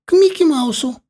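put-on high squeal of a delighted toddler; joyful child's shout. A narrator's reading. Youngest gets to choose first, shouting with joy. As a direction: cry it out like a thrilled little boy. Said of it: "Младшенький просиял и закричал".

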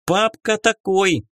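high squeaky fairy voice; a tiny fairy piping up; squeaky pixie. Acted. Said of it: "писклявый голос феи". (писклявый голос феи)

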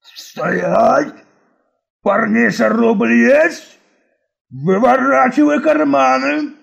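put-on gurgling hoarse drunk's growl; fish man. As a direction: slurp it out like a bog creature. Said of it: "позвал хриплый и булькающий голос".

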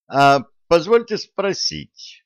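high, slow cheeky interruption; butting in. A narrator's reading. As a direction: wedge in with mock politeness. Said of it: "опять встрял Виталик".

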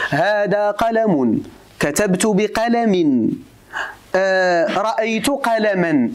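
very slow, each ending clearly sounded out; slow case-ending demo. Shown as a demonstration. Teacher slowly sounds out case endings for students. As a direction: spell out قلم then قلما, drawing out every ending. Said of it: "قلت لك".

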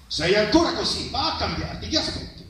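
high, sharp reproach from a mother, trailing off; a mother hurrying her son to get changed; mother scolding. Acted. Mother scolding her son at the wedding feast, sharp and high.

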